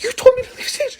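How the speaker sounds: squeaky imitated kid's protest; high-pitched protest. Panicked kid answering mom on stage.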